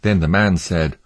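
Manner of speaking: usual speaking voice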